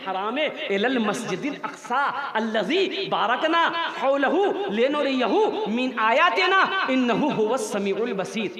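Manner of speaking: measured melodic Arabic recitation; Quran recitation. Real, not acted. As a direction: recite the verse with lengthened syllables. (Quran की आयत की लयदार तिलावत)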